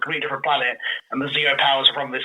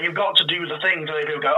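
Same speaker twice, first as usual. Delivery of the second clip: normal talking voice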